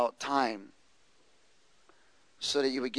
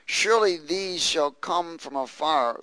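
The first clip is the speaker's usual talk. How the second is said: ordinary talk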